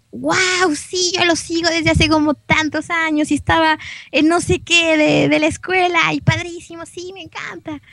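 mock fan excitement in a high, light voice; fan entusiasmado. Imita respuesta entusiasta de fans chilenos. (fan emocionado, voz aguda)

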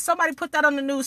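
ordinary delivery

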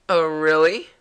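loud questioning lift at the end; doubting. Acted. Voicing the girl's reply to the hero, loud doubting question. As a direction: challenge it with a rising "really". First take of game character's reply.

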